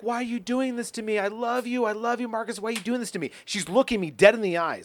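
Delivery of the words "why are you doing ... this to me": high, pleading mock girlfriend voice. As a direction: play her begging him on the phone. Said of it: "and she goes".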